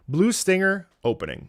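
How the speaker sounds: usual speaking voice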